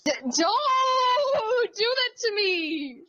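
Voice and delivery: high, slow anguished yell; pained shout. Real, not acted. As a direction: cry out in mock agony. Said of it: "that sound effect is the bane of my whole existence".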